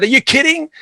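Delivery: imitating an astonished father; incredulous dad. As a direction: retell the dad's outraged disbelief. Dad reacting to the price.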